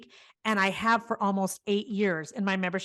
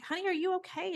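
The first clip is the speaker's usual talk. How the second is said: higher and softer, gently checking on a crying stranger; tender concern; 9 semitones higher, 5 dB quieter than usual. Retold or imitated. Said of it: "and I'm like".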